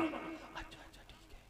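barely audible, voiceless whisper; frightened. Acted. Newcomer yields to the shouting amir, whispering fearfully. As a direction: give in meekly under your breath. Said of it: "बेचारा डर गया".

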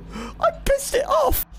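high panicked whoas; scared horror game streamer. Real, not acted. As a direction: cry out in rising panic. Ghost angered, attacking.

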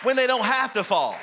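high, loud preacher's shout; full-voiced pulpit shouting; preaching at full force. Real, not acted. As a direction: preach it out hard to the congregation. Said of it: "I'm preaching".

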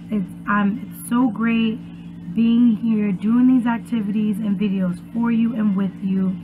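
plain reading voice

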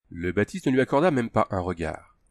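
ordinary delivery

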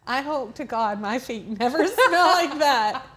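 talking through a real laugh; cracking up at your own joke; amused laughter. Real, not acted. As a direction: let the laugh break into the sentence. Joking about the smelly printout.

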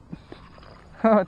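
a burst of loud, high laughter; real happy laugh. Real, not acted. Laughs after hooking fish.

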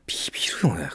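breathy hushed aside; whisper. Real, not acted. Whispered aside to his co-host about the generation gap. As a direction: whisper it under your breath.

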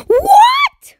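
high, loud shriek of disbelief; shocked outburst. Real, not acted. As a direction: cry out in shock, pitch shooting way up. Shock at the game result.

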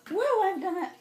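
breathless high woo; gasping from rushing through; out of breath. Real, not acted. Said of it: "I'm running out of breath".

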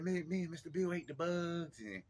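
put-on high, halting child voice; imitated little boy. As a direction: boast like a small kid with big news. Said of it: "He's like".

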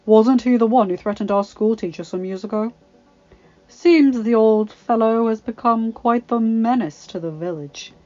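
loud, deliberate insinuation; bully slowly savouring his leverage; smug veiled threat. A narrator's reading. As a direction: lay out the accusation with mock concern. Bully hinting at blackmail.

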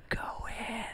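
voiceless high whisper; just mouth it with breath, no voice. Retold or imitated. Farley urging David to the office, voiceless whisper. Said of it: "He's mouthing it".

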